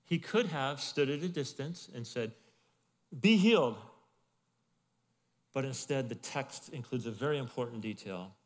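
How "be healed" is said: loud, high command. Retold or imitated. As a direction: heal him with one word. Jesus heals a leper.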